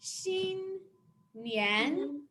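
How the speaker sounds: "Xin" held high, "nian" gliding up; level then rising tones. Shown as a demonstration. Teacher assembling the greeting, high level then rising tone. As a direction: hold "Xin" steady, then lift "nian". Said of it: "go like this".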